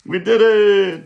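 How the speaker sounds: high, loud cheer; teacher cheers loudly for the class; excited cheer. Real, not acted. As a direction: celebrate the win. Celebrating solved sentence.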